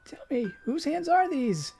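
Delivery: put-on high, slow wondering child; a dying girl delighting in held hands; high happy child voice; joyful little girl. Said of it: "she asks joyfully".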